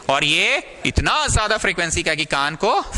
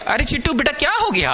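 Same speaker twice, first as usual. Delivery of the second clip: high, playful cooing to a pet; doting pet owner; 8 semitones higher than usual. Acted. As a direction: coo to your little pet in a raised voice. पालतू चिट्टू को पुकारने का अभिनय. (पालतू को पुचकारते हुए, ऊँची आवाज़)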